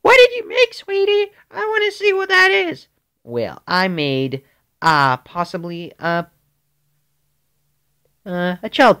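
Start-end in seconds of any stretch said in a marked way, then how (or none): 0.0-2.9 very high, louder sugary mom voice; sweet mommy voice. Retold or imitated. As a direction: play mom in a squeaky high sing-song. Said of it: "my mommy's like".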